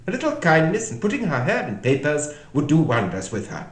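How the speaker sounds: high, loud voice of advice; Red Queen. A narrator's reading. Red Queen advises Alice on kindness, high and emphatic. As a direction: pitch it up and pronounce firmly.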